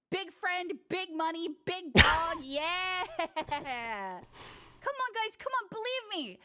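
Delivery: hammed-up bragging. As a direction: oversell it like a brag. Bragging, pleading with chat.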